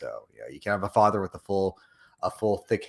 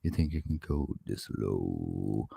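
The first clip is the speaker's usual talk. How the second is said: much lower, slow deep rumble; 5 semitones lower, slower than usual. Shown as a demonstration. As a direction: drop the voice deep into the chest. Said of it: "Let me get my voice fully deep".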